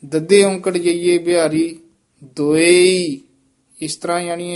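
normal talking voice

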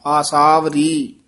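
ਆਸਾਵਰੀ slowly, a long aa at the start; long opening aa. Shown as a demonstration. Teacher models the correct ਆਸਾਵਰੀ, slow and clear. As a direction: stretch the first aa of ਆਸਾਵਰੀ, then ਰੀ straight on. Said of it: "ਆਸਾਵ ਬੋਲ ਕੇ ਨਾਲ ਹੀ ਰੀ ਬੋਲ ਦੇਣਾ".

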